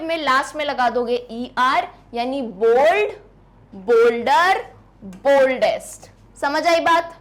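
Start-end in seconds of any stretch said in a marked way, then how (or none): 2.4-6.1 बोल्ड rising, then बोल्डर and बोल्डेस्ट pronounced emphatically; measured model forms. Shown as a demonstration. Teacher models the three degrees to students, spaced and emphatic.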